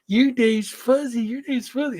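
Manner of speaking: mocking the host's nickname in a high baby voice. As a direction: coo it like a teasing toddler. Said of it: "that voice".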